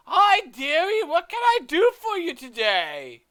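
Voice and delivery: very high, very loud character greeting; shrieky singsong welcome, as if meeting afresh; forgetful shopkeeper greeting. Acted. Shopkeeper greeting a customer she forgot, very high and loud. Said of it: "she seems lost for a moment".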